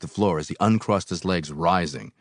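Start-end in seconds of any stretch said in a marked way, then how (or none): none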